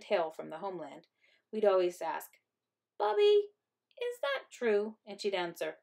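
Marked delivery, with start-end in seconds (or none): 3.0-5.0 put-on high, drawn-out child's question; childlike questioning. A narrator's reading. Children asking their grandmother about her tale, high childlike questioning. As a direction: ask it high, slow and wondering.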